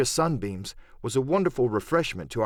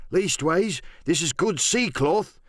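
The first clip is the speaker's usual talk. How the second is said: higher-pitched sailor's voice; pirate weighing up a find, voice raised in pitch; George Merry, bold pirate; 5 semitones higher, slower than usual. Acted. George Merry examining the rags of clothing, higher pirate voice.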